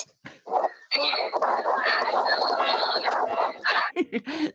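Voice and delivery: long loud wheezing laugh; helpless laughter at the clip. Real, not acted. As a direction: cracks up breathless. Breaks up laughing.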